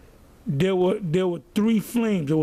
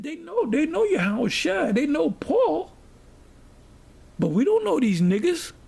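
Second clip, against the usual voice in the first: dismissive demon retold in a higher voice; demons' attitude; 8 semitones higher than usual. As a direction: voice the demons' disdain, pitched up. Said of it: "That was the attitude that these demons took".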